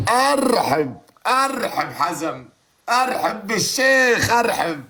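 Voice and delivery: high, drawn-out ceremonial welcome; grand welcome. Pretend. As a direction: greet the sheikh like a host. (ترحيب فخم بطيء)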